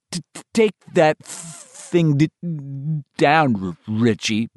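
put-on stammering boy, stuck on first sounds; stuttering. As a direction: push through a heavy stutter. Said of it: "Bill said".

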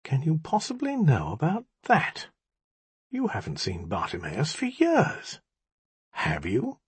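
soft, probing challenge; very quiet. A narrator's reading. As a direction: press the question quietly. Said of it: "he said very quietly".

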